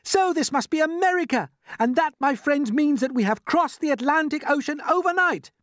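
put-on high, excited boy announcing a discovery; excited shouting. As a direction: proclaim it, bursting with excitement. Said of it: "jumping up and down with excitement".

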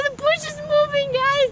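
breathless fast pointing-it-out; excited alarm. Acted. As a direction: blurt it out all at once as the bush moves. Spots the bush moving.

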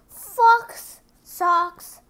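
plain reading voice

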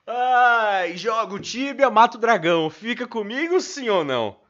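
loud, high excited yelling; excited shout. Real, not acted. Excited cheering at game. (gritando empolgado)

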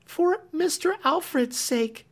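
high, earnest simple plea; homely earnestness. A narrator's reading. Clemency appealing to Marian for Alfred's sake, earnest and high. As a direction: plead plainly from the heart. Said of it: "with homely earnestness".

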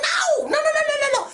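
high frantic shouted no, no, no. Retold or imitated. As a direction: shout them off, panicked and high. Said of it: "And they'll be like".

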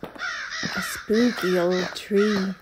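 ordinary delivery

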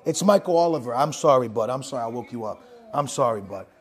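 low-pitched, soft consoling voice; soothing apology. Real, not acted. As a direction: gently apologize for waking your buddy. Apologizing for waking Scrappy.

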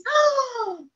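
loud shocked gasp. Acted. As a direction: gasp in mock horror. Reader shocked by silly text.

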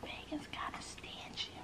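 a slow, breathy whisper so nobody overhears. Real, not acted. Gossiping to viewers about a stingy roommate, whispering. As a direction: hush it like gossip you shouldn't be heard saying. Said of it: "I don't know why I'm whispering".

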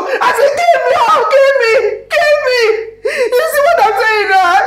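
high, loud sobbing wail with laughs; stage a tearful breakdown, kill me; dramatic wailing. Acted. Mother fake-wailing at son to guilt him, theatrical sobbing. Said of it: "please stop crying".

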